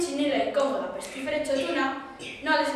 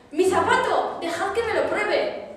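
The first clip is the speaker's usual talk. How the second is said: higher, louder cry; eager shout; 6 semitones higher, 4 dB louder than usual. A narrator's reading. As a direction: shout it, pushing to the front. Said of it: "gritó la otra hermanastra". (grito ansioso, más agudo y fuerte)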